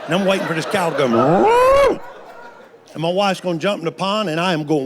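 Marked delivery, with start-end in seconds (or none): 1.1-2.0 a high, loud mooing cow call. Retold or imitated. Grandpa imitating the cow sneaking up on his wife, loud high moo. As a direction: moo like the cow right behind her.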